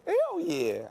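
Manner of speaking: Hell yeah jumps way up high with glee; eager high-pitched hell yeah; excited hell yeah. Real, not acted. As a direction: shout it out like you can't wait. Eager answer about being booed.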